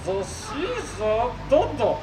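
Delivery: high, slow robot voice with garbled consonants. Acted. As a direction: mangle the consonants like a glitchy robot. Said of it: "souffre d'une pathologie vocale".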